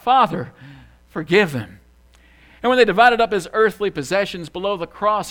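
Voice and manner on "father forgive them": loud retold cry, falling in pitch. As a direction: call it out to heaven, pausing after Father. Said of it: "he cried out".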